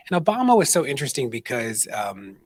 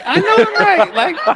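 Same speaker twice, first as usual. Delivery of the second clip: laughing retort; 11 dB louder, slower than usual. Retold or imitated.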